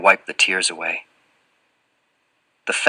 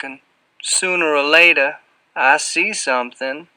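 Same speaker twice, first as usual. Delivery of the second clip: slow, higher-pitched Southern character voice; Southern accent; 6 semitones higher, 3 dB louder than usual. A narrator's reading. Fat man tells a visitor of his cage, Southern drawl. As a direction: draw the words out in a Southern drawl. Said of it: "drips with a heavy Southern accent".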